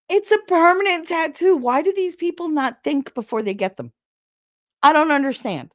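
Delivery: high, loud disbelief; exasperated rant. Real, not acted. Ranting at people who don't think, exasperated. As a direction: protest it, baffled and worked up. Ranting about thoughtless tattoos.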